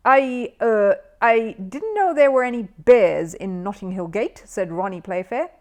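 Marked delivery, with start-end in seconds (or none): 0.1-4.4 louder, hesitant showman voice; a host caught off guard, stammering; flustered compere. Acted. Flustered quizmaster.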